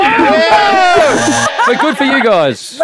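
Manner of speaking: high, loud whoop; triumphant high shout; excited cheer. Real, not acted. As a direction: cheer at full voice. Host cheers caller's reveal.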